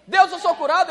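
loud, ringing cry of faith; bold declaration. Pretend. Believer proclaiming healing to God, loud and bold. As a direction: shout the claim up to God.

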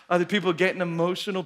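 ordinary talk, nothing marked

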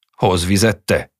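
loud, sharp order; shouting husband. Acted. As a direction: yell the order back at her. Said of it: "Kiáltott rá a férje".

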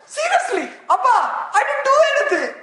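high, loud, fast protest as Jesus; a hammed-up high-pitched son insisting he did nothing; Jesus pleading. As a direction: cry out to the Father in a high rushed shout. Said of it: "Jesus said".

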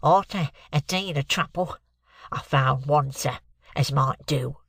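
slow, congested client voice; stuffed-up cold. Acted. Client Mike answers the lawyer, bunged up with cold. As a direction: talk through a heavy head cold. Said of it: "voice of a sufferer from a constitutional cold".

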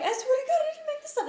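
very high, squeaky protest; pleading insistence. Retold or imitated. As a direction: beg them to believe you. Insisting to friends.

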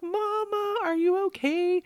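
put-on high, loud cry of alarmed kids; worried children. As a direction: imitate children calling to mom in concern. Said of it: "they were both like".

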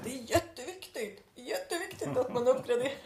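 high-pitched, hammed-up indignation; mock upset. Retelling an engineer's comment about upgrades, mock upset. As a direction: imitate someone worked up, voice pitched well up. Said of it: "varit lite upprörd och bara".

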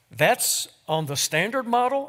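a jolt of surprise; surprised question. A narrator's reading. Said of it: "asked the angel".

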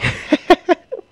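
high-pitched, loud giggle; high embarrassed laughter. Real, not acted. Laughs at own embarrassing story.

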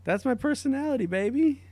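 high, cocky teasing tone; cheeky boast. Acted.

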